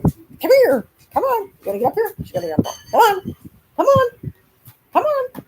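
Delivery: very high sing-song dog call; coaxing puppy. Real, not acted. Owner urging puppy to jump up, high sing-song.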